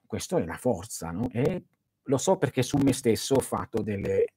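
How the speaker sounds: ordinary delivery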